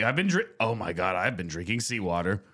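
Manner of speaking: usual speaking voice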